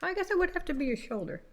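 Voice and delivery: strained, rushed while wrestling a cat; holding down a pet. Real, not acted. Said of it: "I'm trying to wrestle a cat while I'm doing this".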